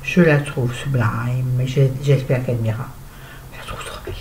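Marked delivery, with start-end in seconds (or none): 3.5-4.1 whispering. Real, not acted. Whispered admiration.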